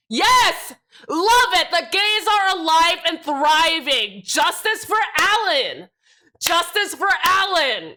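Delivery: high, loud, drawn-out cheer; rally the crowd with stretched-out, high cries; excited cheering. Real, not acted.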